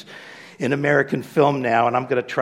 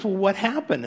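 curious amazement; 8 semitones higher than usual. Retold or imitated. Man questions talking dog.

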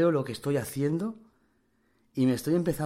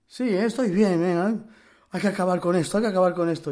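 higher, louder voice close to cracking; 6 semitones higher, 6 dB louder than usual. Retold or imitated. Tough inmate insisting he's fine, voice cracking. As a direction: hold it together while the voice breaks. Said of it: "la voz un poco así como crujida".

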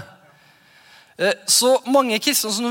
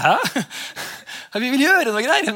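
higher, laughing half-hammed disciple voice, puzzled; mock confused disciples; 5 semitones higher than usual. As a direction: play the baffled disciples with a chuckle. Disciples' confused reply to Jesus. (lett spøkefull, forvirret disippelstemme)

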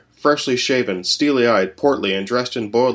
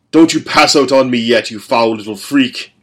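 loud, menacing threat; louder snarling father; father's growl; 3 semitones higher, 6 dB louder than usual. A narrator's reading. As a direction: bark at him to stay awake. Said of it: "his father growled".

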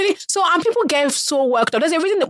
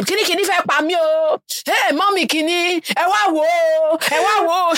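wailing mother; 6 semitones higher than usual. Retold or imitated. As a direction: cry out for help dramatically.

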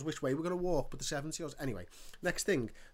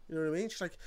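normal talking voice

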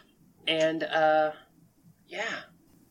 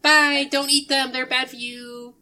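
high sing-out farewell, stretched at the end; calling out; 12 semitones higher, 8 dB louder than usual. Acted. Sushi Kid warning departing adventurers, cheerful high call. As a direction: call after them, high and chipper.

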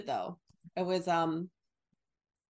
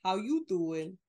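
slow, drawn-out New Jersey greeting; New Jersey accent. Pretend. Hamming up the hometown greeting, slow New Jersey accent. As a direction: stretch it out like a New Jersey local. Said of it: "you know, New Jer".

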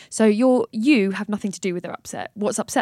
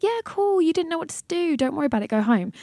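breezy brush-off; 8 semitones higher than usual. Retold or imitated. Breezy dismissal voiced in her argument.

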